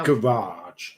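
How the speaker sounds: "Garage" said American-style as guh-RAHZH; American pronunciation. Retold or imitated. Giving an example of Connor's American words, "Garage" as guh-RAHZH. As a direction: stress the long second syllable of "Garage". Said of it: "that sounds more American".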